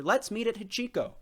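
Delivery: bright, loud and high; cheerful invitation. A narrator's reading. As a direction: call it out like friends making plans. People greeting each other.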